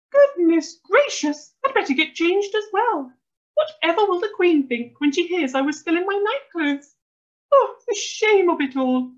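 high, loud, scandalised fussing; flustered mum. A narrator's reading. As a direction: wail in mock-genteel dismay. Mum horrified at being in nightclothes.

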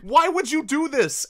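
loud, high-pitched outraged shouting; furious rant. Real, not acted. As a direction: wail your outrage, stretching the final questions. Furious rant about missing accent.

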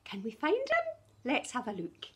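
ordinary delivery

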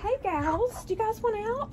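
high sing-song greeting to the hens; high chicken-calling voice. Real, not acted. To her hens in the cold coop, a high sing-song greeting. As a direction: coo up high like calling pets.

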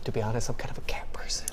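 hushed, sheepish aside; whispering confession. Real, not acted. Confessing cat preference.

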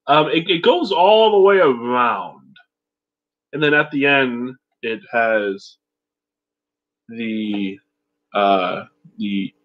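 muffled behind rubber mask; speaking through a held gas mask. Real, not acted. Seen 0: Gas mask pressed over mouth and nose, lowered briefly near 5.4.

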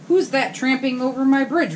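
high, loud angry roar; troll roar. Acted. Troll bellowing at the big goat, high and loud. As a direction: bellow at the intruder. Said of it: "roared the troll".

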